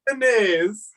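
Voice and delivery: high, slow hammed-up Italian accent. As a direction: draw it out like an Italian chef. Echoing the Italian bit.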